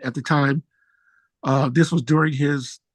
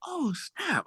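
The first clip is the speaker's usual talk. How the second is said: surprised; 10 semitones higher, 10 dB quieter than usual. Retold or imitated. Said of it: "I was like".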